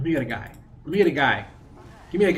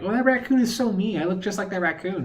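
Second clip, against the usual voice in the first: high, gushing mock girl voice; mock girly voice; 8 semitones higher than usual. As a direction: imitate a girl gushing over her raccoon profile picture. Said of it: "they're like".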